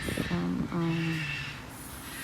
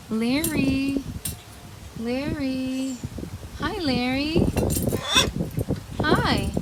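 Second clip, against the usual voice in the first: pet talk; 5 semitones higher, slower than usual. Real, not acted. Greeting parrots by name.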